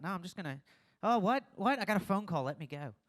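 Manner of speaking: sudden high, loud oh what; hammed-up fake surprise; fake phone-call escape. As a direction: feign a call to flee.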